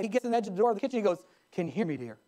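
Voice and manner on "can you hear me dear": low, quiet acted question; soft test call. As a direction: try it softly in a husband's low voice. Husband testing from the doorway.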